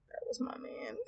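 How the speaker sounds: high, hushed whisper; wistful whisper. Acted. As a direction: whisper it to yourself over his profile. Gazing at old boyfriend's profile.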